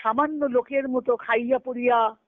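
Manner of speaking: ordinary delivery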